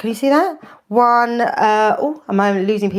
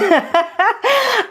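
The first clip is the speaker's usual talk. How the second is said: high nervous laugh; a short high embarrassed giggle; 11 semitones higher than usual. Real, not acted. Nervous cook asking viewers for feedback, giggling.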